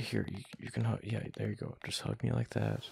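ordinary delivery

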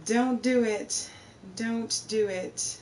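slow pleading. Real, not acted. Drawn out repeated plea.

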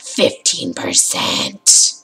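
low, slow, whispery ghoul; creepy voice. Pretend. Croaking the progress from the grave, creepy low voice. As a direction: drag it out like a creepy ghost. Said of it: "That was a creepy voice!".

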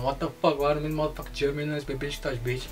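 fast, low-volume preview of a shout; hushed rehearsal. Pretend. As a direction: run through the yell quickly under your voice. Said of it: "Ik ga gelijk schreeuwen van". (zachte, snelle repetitie van geschreeuw)

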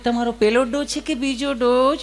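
nurse voice. Acted.

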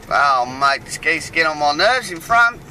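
normal talking voice

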